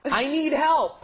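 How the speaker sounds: comically desperate appeal; mock plea. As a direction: plead slowly, hamming up the helplessness. Comic skit, begging to be rescued.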